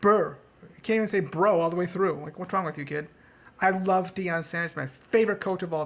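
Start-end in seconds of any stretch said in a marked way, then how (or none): 0.0-0.4 louder lip-buzzed "burr" for bro; mocking how the quarterback talks. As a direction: spit out a lazy brr instead of bro. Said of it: "they don't talk like this".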